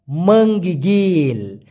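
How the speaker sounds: মঙ্গিগিল drawn out slowly, syllable by syllable; slow demo word. Shown as a demonstration. Teacher shows the Malay word to students, slowly. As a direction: spell out মঙ্গিগিল slowly for learners. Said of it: "মঙ্গিগিল মানে হচ্ছে".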